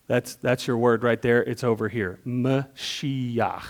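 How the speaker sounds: ordinary talk, nothing marked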